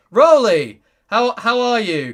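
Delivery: loud, bright hail of a name; excited call-out. Real, not acted. Streamer calling out to a chat regular, excited. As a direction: greet a friend from across the room.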